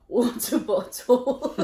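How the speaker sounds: failed British accent. Shown as a demonstration. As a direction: overdo a British "water bottle", badly. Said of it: "ever successfully said".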